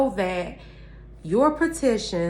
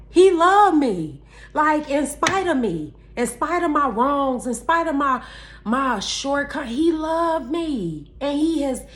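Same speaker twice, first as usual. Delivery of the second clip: overjoyed testimony; 6 semitones higher than usual. Real, not acted. Testifying overwhelmed by God's love.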